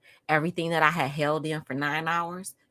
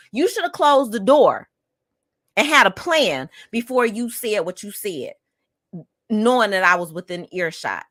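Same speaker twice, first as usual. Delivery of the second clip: heated, louder telling-off; kızgın azarlama; 4 semitones higher, 6 dB louder than usual. Retold or imitated. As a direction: lay into them, stressing each accusation. Imagined angry scolding of a relative.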